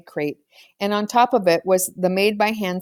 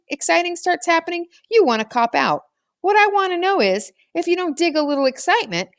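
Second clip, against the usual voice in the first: higher, teasing boy's voice mocking his friends; Toby's voice; 10 semitones higher than usual. A narrator's reading. Toby taunting the others in a high, mocking voice. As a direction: pitch it up and needle them like a cocky kid.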